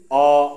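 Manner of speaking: অ with the mouth opened too far; cracked, spoiled sound. Shown as a demonstration. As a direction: show an ugly, overdone অ. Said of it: "আওয়াজটা ফেটে যাবে". (বেশি হা করে ফাটা 'অ')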